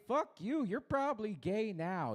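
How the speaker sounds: hammed-up heckle; mock outburst. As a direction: yell it at the crowd in jest. Mock rant at audience.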